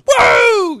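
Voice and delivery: big delighted whoa from the crowd; excited shout. Retold or imitated. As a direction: shout it out, thrilled. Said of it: "somebody in the audience goes".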